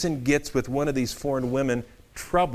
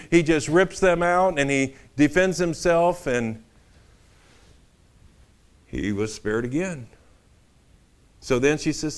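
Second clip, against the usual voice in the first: ordinary talk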